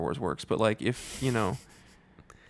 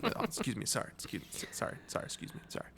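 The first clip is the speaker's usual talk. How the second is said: softer, fading to a whisper; whispered apologies; 10 dB quieter, faster than usual. Pretend. Moviegoer sneaking back to their seat: fading whispered apologies. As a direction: whisper apologies squeezing past seats. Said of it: "And then".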